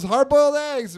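put-on high, loud kid battle cry. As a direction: shout it like a boy leaping off a swing. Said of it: "he jumps off the swing and goes".

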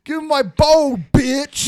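high, loud East Coast demand; East Coast dog. Acted. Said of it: "But East Coast dogs are".

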